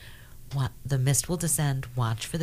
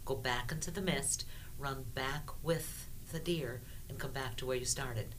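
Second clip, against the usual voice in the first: guided meditation; 11 dB quieter than usual. Acted. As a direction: quietly lead them through the journey. Guides the listeners' spirit journey.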